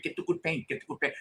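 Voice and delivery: put-on hushed, lightly accented "Get the good paint"; imitating a Greek painter. Quoting the Greek house painter neighbor in a soft accent. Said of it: "that's how he said it".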